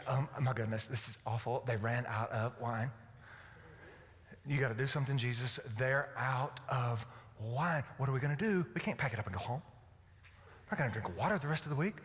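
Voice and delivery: low, hushed fretting mother; imagined worried Mary. Acted. Preacher acts Mary fretting to Jesus about the wine, hushed worry. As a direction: fret under your breath to your son. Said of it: "I just imagine this".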